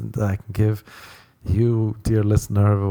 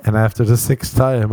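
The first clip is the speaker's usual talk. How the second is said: ordinary talk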